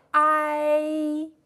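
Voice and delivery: ai held long, mouth going from wide open to flat; drawn-out pinyin ai. Shown as a demonstration. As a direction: glide smoothly from a into i, steady and even. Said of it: "就像这样".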